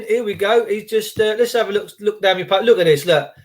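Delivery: ordinary talk, nothing marked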